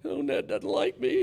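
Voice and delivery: high mock-wounded whine; hurt whine. Young reporters sulking about the manager, mock whiny. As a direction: mimic a sulky rookie, pitched high. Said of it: "The young guys were like".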